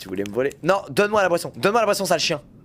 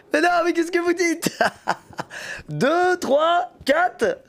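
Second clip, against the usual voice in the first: laughing; 11 semitones higher, slower than usual. Real, not acted. Mock outrage at chat, laughing.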